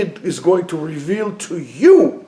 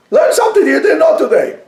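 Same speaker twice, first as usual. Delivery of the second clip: exhortation shout; 10 semitones higher, 4 dB louder than usual. Real, not acted.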